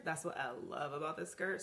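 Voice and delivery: ordinary talk, nothing marked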